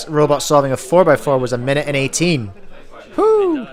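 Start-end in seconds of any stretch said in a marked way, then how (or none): 3.2-3.7 very high delighted whoop; cheer. Real, not acted. Cheering the robot's record with a high whoop. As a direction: celebrate the record out loud.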